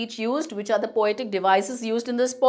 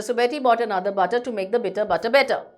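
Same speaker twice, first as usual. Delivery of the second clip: rapid tongue twister; much faster than usual. Shown as a demonstration. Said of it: "one more example".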